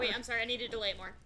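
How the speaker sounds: ordinary talk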